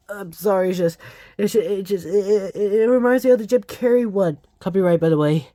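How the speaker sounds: ordinary talk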